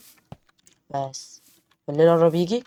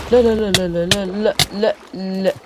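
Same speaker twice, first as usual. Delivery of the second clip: panicked no; 3 semitones higher, 5 dB louder than usual. Real, not acted. Panicked denial in game.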